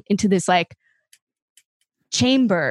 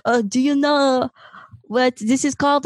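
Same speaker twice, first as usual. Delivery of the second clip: imitating a French tour guide, higher pitched; French accent; 4 semitones higher than usual. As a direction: put on a mock French accent. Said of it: "has a French accent".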